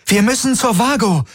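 high, urgent cry; calling out. A narrator's reading. Kirai urges going to the Vago, high urgent cry. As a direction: insist in a high-pitched call. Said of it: "rief Kirai".

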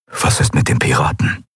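low, half-whispered question; hushed and low, for one listener only. A narrator's reading. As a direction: ask it confidentially, under your breath. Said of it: "fragte er mit gesenkter Stimme".

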